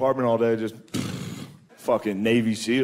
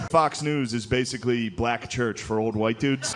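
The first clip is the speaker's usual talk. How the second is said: ordinary delivery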